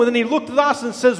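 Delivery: normal talking voice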